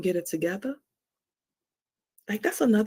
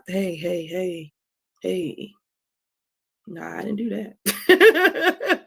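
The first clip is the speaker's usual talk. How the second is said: mock dismissive daughter, drawn out slow; daughter brushing it off; 3 dB louder, much slower than usual. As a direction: play the kid waving it away with a laugh. Voicing daughter's dismissive reply.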